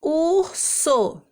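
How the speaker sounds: two separate beats in "Urso"; one syllable at a time. Shown as a demonstration. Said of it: "pronunciando uma sílaba de cada vez".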